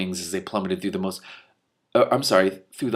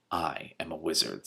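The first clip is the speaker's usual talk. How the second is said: softer, lower, hollow and still; lowered quiet wizard; 6 semitones lower, 10 dB quieter than usual. Acted. Dead wizard to lore master, low and quiet. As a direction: speak like a ghost at his ear.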